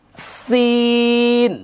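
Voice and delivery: সিন with the s held long and airy; long hissed sin. Shown as a demonstration. Teacher stretching সিন so air streams out. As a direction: hold the hissing s like escaping air. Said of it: "আমার সাথে সাথে বলতে হবে".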